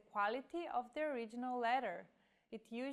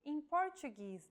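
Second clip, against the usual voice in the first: ordinary talk, nothing marked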